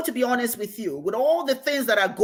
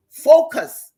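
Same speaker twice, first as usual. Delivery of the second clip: focus said much higher and louder, punched; emphatic focus; 7 semitones higher, 10 dB louder than usual. Shown as a demonstration. Teacher urges listeners to focus, punched loud. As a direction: hit the word focus hard. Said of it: "emphatic coaching".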